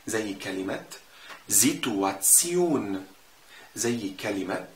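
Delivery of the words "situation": slow, lower زيتواتسيون with TS in the ending; TSION ending. Shown as a demonstration. Teacher giving an example word, slow TS in زيتواتسيون. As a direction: stretch زيتواتسيون, tion said as tsion. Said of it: "بنطقهم تسيون".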